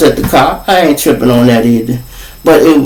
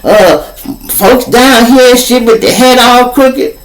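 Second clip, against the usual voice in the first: raised, aggrieved lecture; scolding dealer; 8 semitones higher than usual. Retold or imitated. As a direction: pitch up and scold him for bringing folks. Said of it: "He said".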